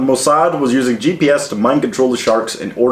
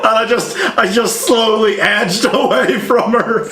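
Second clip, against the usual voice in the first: laughing; 9 semitones higher than usual. Real, not acted. Laughing while retelling.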